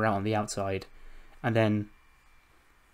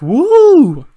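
high, very loud drawn-out "woo"; whoop; 17 dB louder than usual. Acted. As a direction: let out a long celebratory whoop. Acts wrestler celebrating on the mic.

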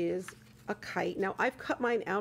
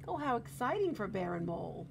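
usual speaking voice

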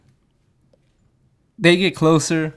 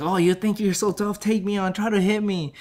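higher-pitched, goading imitation; mock taunting challenge; 6 semitones higher than usual. Offended fighter dares the old boxer, mock high taunt. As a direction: dare him in a raised pitch.

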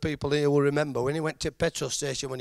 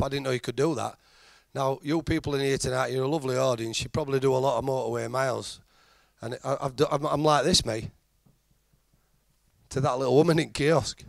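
usual speaking voice

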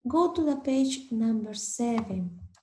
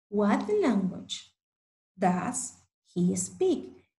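slow clear model question; 3 semitones lower, slower than usual. Shown as a demonstration. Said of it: "slow model question for learners".

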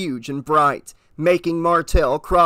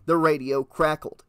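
ordinary delivery

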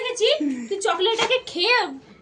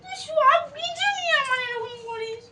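put-on crying like a child; 7 semitones higher than usual. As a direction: wail it like a sulking child. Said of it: "বাচ্চাদের মতো কাঁদো না".

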